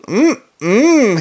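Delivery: high, appreciative hum; savouring while chewing. A narrator's reading. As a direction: hum with relish through a mouthful. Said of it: "powder spraying everywhere as he masticated".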